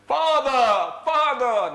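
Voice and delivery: high, loud call across the house. Acted. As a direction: shout for Father. Son calls out to his father.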